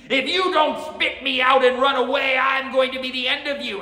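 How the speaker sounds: high, sustained heroic shout; great-voiced hero. A narrator's reading. Samurai threatening from inside the Oni, loud high shout. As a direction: bellow the warning at full force. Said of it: "great a voice could be heard saying".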